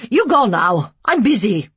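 loud curt broken English. A narrator's reading. Care worker sending the couple away, curt broken English. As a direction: dismiss them curtly. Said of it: "in broken English".

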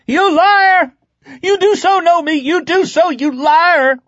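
loud, very high frantic screaming; screamed accusation. A narrator's reading. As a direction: scream like a desperate, betrayed man. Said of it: "Heck began to scream again".

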